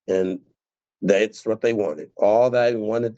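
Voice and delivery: usual speaking voice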